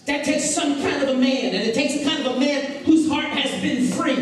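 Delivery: high-pitched, urgent sermon delivery; exhorting, high and insistent; impassioned preaching. Acted.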